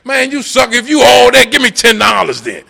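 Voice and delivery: high, loud taunting jeer; mocking heckler. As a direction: sneer it like a street heckler. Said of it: "them people who still saying".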